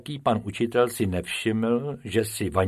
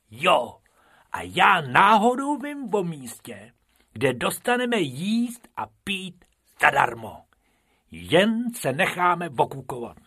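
higher, eager little rabbit voice; Bobek, higher, pleased with his free-food plan; Bobek, sly rabbit; 8 semitones higher than usual. A narrator's reading. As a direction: let him boast he knows a secret place. Bobek's voice in story.

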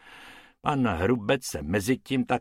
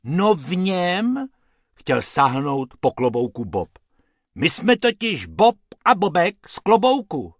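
higher, chirpy rabbit voice; sweet, eager little rabbit explaining; small rabbit talking politely; 7 semitones higher, 6 dB louder than usual. A narrator's reading. Rabbit Bob's voice.

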